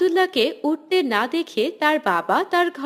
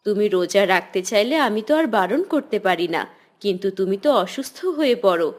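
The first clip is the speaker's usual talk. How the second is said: a hint of a concerned mother, pitched lower; mother gently cautioning her son, lightly voiced lower; 8 semitones lower than usual.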